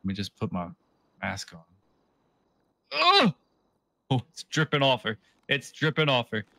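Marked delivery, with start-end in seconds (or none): none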